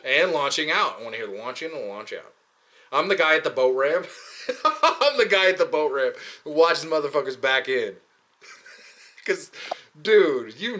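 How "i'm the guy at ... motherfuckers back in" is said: loud, laughing admission; amused confession. Real, not acted. Admitting he watches people at the boat ramp, amused and laughing. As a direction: break into laughter while owning up.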